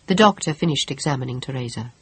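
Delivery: plain reading voice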